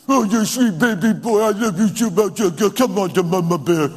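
gushing sing-song mama voice; mock baby talk. Mother cooing to her baby boy, exaggerated baby talk. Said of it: "don't talk baby talk to your children".

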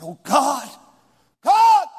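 loud, high, rising outcry; angry cry to God. Retold or imitated. Driver on a night road crying out at God, loud and high. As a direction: hurl the words at God in frustration. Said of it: "when I addressed him like that".

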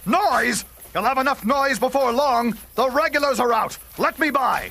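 angry rider refusing to be hushed; indignant outburst. Acted. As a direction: throw his word back at him, furious.

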